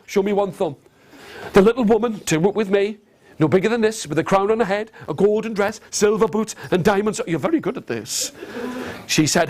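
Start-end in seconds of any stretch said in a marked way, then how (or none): none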